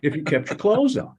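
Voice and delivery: half-shouted mock exasperation; mock scream. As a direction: finish the colleague's mock rant. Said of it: "he'd be screaming, going".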